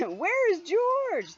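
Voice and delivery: high, loud, slow call across the deck; hammed-up sailor shout, drawn out; storybook sailors calling for the monkey. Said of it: "The sailors looked and looked".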